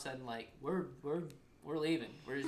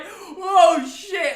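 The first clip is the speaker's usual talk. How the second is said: high, loud exclamation of disbelief; re-enacted shock; 18 dB louder than usual. Friend witnessing the mom's savage comeback, shocked. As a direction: relive the stunned reaction, pitched way up.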